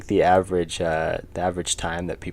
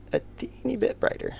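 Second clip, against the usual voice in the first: put-on "a teeny bit brighter" in a much higher, tiny, breathy voice; squeaky high voice; 4 dB quieter than usual. Shown as a demonstration. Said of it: "I failed".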